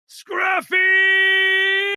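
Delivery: long, high, very loud scream of a name; furious scream. Acted. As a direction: scream it so the windows burst. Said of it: "And he just screams".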